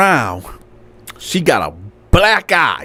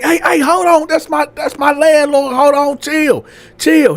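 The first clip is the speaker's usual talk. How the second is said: high-pitched pleading to calm down; urgent girl intervening; 9 semitones higher than usual. Acted. Girl telling the driver that's her landlord, urgent. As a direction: play a woman hurriedly stepping in.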